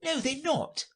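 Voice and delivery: high-pitched rabbit reporting; high, light courtier rabbit. Acted. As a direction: explain in a very high voice.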